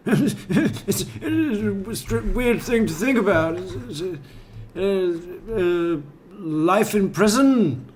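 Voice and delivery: high, stammering mock interviewee; Chris Watts impression. Host mimicking Chris Watts answering what should happen, stammering high. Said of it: "He does this weird up inflection thing at the end".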